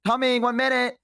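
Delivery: high, cheery mock host voice; high bright mock greeting; sing-song fake politeness. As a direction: chirp it like a pretend butler. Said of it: "it would be like".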